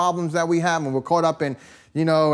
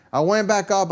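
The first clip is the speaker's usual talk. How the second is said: ordinary talk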